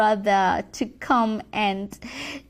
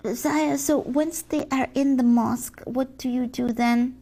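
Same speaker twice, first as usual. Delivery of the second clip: ordinary talk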